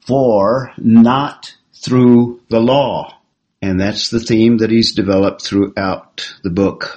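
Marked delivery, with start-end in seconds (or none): 0.0-3.2 each word of "For not through the law" slow and louder, leaning on "through"; slow emphatic quote. Shown as a demonstration. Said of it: "it would be literally".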